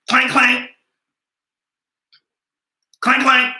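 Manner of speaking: high-pitched mock jail-door clank; imitated clanking. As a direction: sound out the cell door slamming. Imitates jail doors clanking shut.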